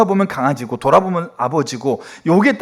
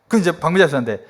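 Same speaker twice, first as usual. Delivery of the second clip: usual speaking voice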